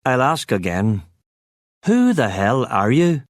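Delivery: a prisoner demanding to know who his visitor is. A narrator's reading. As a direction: press him for an answer. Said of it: "I demanded".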